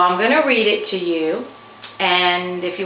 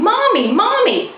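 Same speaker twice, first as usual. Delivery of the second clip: high, louder mock cry of Mommy; crying child's call; 14 semitones higher, 4 dB louder than usual. Matthew imitates the crying voice calling for Mommy. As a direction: call out like a lost little kid. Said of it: "I heard a voice crying".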